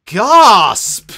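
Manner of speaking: the word gasp said loud and high; spoken gasp. Acted. Reading the gasp aloud at the quake, loud spoken gasp. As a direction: announce gasp like a shock.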